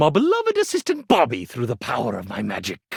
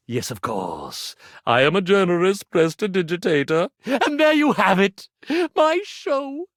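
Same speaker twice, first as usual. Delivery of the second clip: ordinary talk, nothing marked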